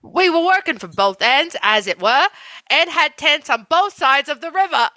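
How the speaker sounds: put-on British storytelling; British accent. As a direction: read it in an amateur British accent. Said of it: "just do it in an accent".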